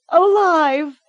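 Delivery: high, loud strained cry; straining shout. A narrator's reading. Girl forces out the lie, loud strained cry. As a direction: force the word out through pain. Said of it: "she persisted".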